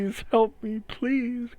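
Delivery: high-pitched wounded dog begging for help; pleading dog voice. Acted. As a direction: beg in a high, pained dog voice. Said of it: "you hear the words".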